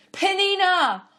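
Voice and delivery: loud, high, drawn-out call across the camp. A narrator's reading. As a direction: call her name into the crowd. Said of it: "Adiver called out".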